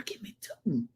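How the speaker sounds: hushed whispered aside. Real, not acted. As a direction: whisper it conspiratorially.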